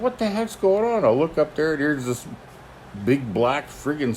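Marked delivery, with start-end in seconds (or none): 0.0-1.0 a much higher, startled exclamation; surprised exclaim. Retold or imitated. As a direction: blurt it out, caught off guard. Startled by a snake.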